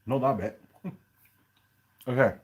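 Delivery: talking with a mouthful; mouth full. Real, not acted. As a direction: speak through a bite of pie. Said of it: "Don't talk your mouth full".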